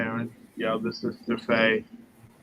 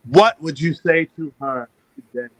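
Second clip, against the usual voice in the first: raised, louder voice to be heard; louder for the microphone; 5 dB louder than usual. Real, not acted. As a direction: repeat it up and louder into the mic. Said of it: "closer to the mic".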